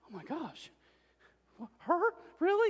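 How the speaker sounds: a mother who can't believe her daughter praised her, rising to a squeal; stunned disbelief. Retold or imitated. Wife amazed at daughter's praise.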